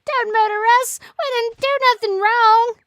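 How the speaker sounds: high, loud, squeaky pleading; desperate tiny plant voice crying for mercy; begging plant. Pretend. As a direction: beg for your life like a little plant. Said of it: "And it starts begging you".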